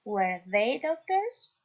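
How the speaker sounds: slow, careful English question; teacher modelling a question to the class slowly; slow model reading. Shown as a demonstration. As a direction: spell it out slowly for learners. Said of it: "teacher reads example question".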